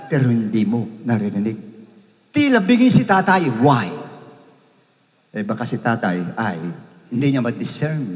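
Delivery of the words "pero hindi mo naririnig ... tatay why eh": ordinary delivery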